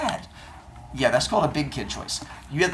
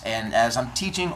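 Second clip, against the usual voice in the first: ordinary talk, nothing marked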